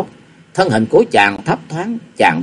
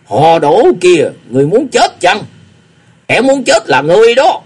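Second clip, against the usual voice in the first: furious beggar threatening his rival with death, shouting; angry shout; 7 semitones higher, 5 dB louder than usual. A narrator's reading. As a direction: bellow the threat, rising in rage. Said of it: "tức giận rồi quát hỏi".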